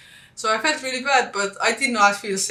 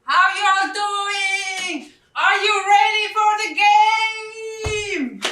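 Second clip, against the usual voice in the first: announcer shout; 11 semitones higher, much slower than usual. Acted. Greets Pittsburgh viewers before the game, booming announcer call. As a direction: rev up the crowd like a game-day announcer.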